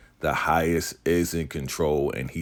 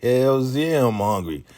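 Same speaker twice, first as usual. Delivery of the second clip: emphatic hunger; 9 semitones higher, 5 dB louder than usual. Retold or imitated. Voicing starving Laura's emphatic answer.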